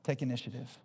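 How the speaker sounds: God, low and soft, lightly voiced; a hint of a quiet inner voice of God correcting him; God's gentle voice. Preacher voicing God nudging him to apologize, low and soft. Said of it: "And then I hear God saying".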